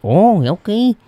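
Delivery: put-on Minnesota accent, bright and high; a playful put-on Minnesota "oh, okay"; imitating a Minnesotan reply; mock Minnesota accent. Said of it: "you're like".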